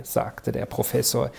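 ordinary delivery

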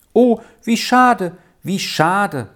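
high lament that sinks on the repeat; sighing regret. A narrator's reading. Rolf watches Jul leave for the stable, sighing regretfully. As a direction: lament it with a mild sigh. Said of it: "sagte Rolf seufzend".

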